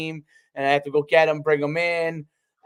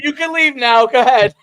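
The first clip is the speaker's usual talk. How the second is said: higher and louder brush-off; mock dismissal; 8 semitones higher, 8 dB louder than usual. Kyle telling the teasing player to leave, loud mock brush-off. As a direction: shoo him away, playing Kyle.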